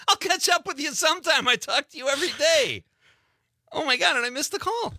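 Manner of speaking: very high-pitched comic exasperation; exasperated mock rant. Acts out frustration with chatty caller.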